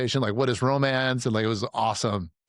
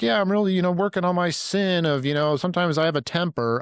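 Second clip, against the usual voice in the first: high, louder, hammed-up preachy humility; mock pastor confession; 7 semitones higher, 3 dB louder than usual. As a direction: parody a pastor admitting a harmless sin. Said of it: "the best I could get from any pastor".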